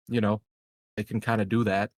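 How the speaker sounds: ordinary talk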